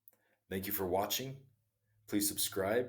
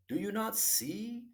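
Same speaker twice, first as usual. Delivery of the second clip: knowing question; slower than usual. Acted. Hermit asks king knowingly.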